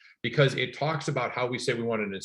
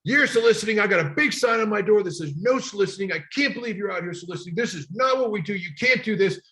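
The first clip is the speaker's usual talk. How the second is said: imitating a furious homeowner, much higher and louder; angry homeowner; 10 semitones higher, 4 dB louder than usual. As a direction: rant like the pissed-off neighbor who hates solicitors.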